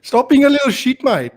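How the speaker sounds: high-pitched mock Aussie accent; Australian accent. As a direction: put on an Australian mate's accent. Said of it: "that's the Australian one".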